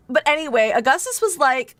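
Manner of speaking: usual speaking voice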